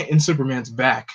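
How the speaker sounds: usual speaking voice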